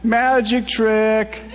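high, slow shout. A narrator's reading. Brit shouting at the bus, high and slow. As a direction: call it out long and high.

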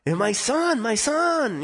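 very high, drawn-out pleading imitation; insistent mom. Mom pestering the scout about her son, high and insistent. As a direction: mimic a mother excitedly pestering someone.

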